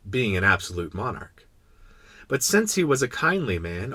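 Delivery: plain reading voice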